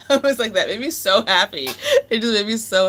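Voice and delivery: giddy laughing words. Real, not acted. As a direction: laugh as you say it. Laughing while telling the story.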